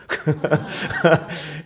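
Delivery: self-amused little laugh; chuckle. Real, not acted. Preacher admitting he often tells army stories, chuckling. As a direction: chuckle at yourself briefly.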